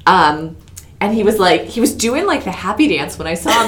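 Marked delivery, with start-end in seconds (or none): none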